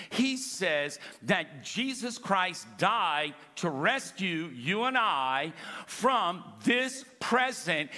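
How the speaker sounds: ordinary talk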